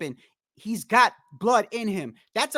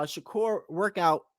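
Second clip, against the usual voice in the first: ordinary talk, nothing marked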